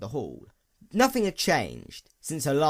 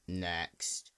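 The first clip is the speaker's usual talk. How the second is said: low, flat-toned bored official; weary clerk at the border desk; bored officer; 8 semitones lower, 11 dB quieter than usual. A narrator's reading. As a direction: call the next one like you've done it all day. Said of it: "frustration and boredom drilled into the immigration officer's voice".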